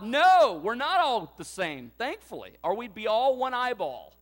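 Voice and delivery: emphatic loud, high answer, strongest on the first word; good loud no. Acted. Congregation answering Paul's rhetorical question, good and loud. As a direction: belt out the no, then carry on loudly. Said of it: "go a good loud".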